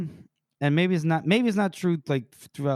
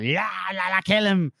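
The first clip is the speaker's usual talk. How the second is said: babbling, raised mock war cry; imitated frenzied yell; 4 semitones higher, 3 dB louder than usual. Imitating a spectator urging the kill, mock frenzied yell. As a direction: gabble it, building to a yell. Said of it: "Even Rick is like".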